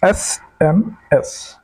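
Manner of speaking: SMS drawn out with a falling end; slow teacher spelling, falling; slow spelled-out letters. Shown as a demonstration. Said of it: "क्या बोल रहे हैं?".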